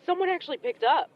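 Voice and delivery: laughing disbelief. Acted. Caller amazed anyone answered, laughing disbelief.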